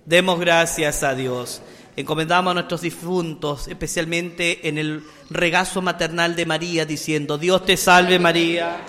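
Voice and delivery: loud, solemn priestly blessing; liturgical blessing. Acted. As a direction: bless the congregation from the altar. Priest closes mass.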